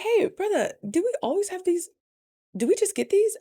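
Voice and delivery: put-on high, sweet little-girl voice; sweet question. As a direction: ask it like his little princess. Sweet question to owner's son.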